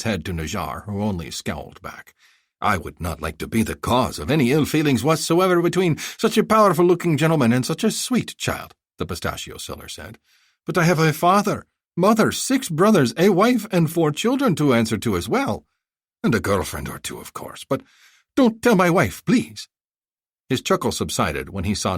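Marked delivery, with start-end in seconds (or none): 2.6-8.7 high, effusive haggling vendor; a flattering bazaar seller pitching; eager merchant. Acted. 10.7-19.7 high, effusive haggling vendor; a flattering bazaar seller pitching; eager merchant. Acted. Vendor joking, pleading.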